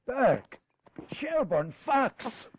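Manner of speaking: rising cries of dismay, name by name; the king cries out each hated name; outraged shouts. Acted. King cries out the names of his new ministers, dismayed.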